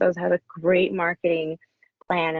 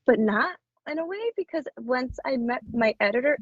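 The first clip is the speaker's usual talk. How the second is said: ordinary talk, nothing marked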